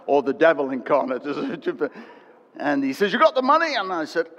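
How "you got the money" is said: loud, high demanding question; an austere principal demanding the fees; stern principal. Retold or imitated.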